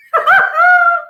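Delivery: high, loud burst of happy laughter; gleeful laugh. Acted. Guji Guji laughs with joy at being a crocoduck. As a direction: laugh out in pure delight.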